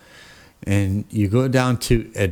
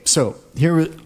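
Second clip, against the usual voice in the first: ordinary delivery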